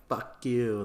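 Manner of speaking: acted heckle, half-shouted; mock screamed insult. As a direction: hurl it like one of a jeering crowd. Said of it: "they're all screaming insults back and forth".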